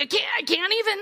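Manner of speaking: big comic meltdown cry; exasperated wail. Retold or imitated. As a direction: throw up your hands, hammed up. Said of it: "We've all done that a lot this year".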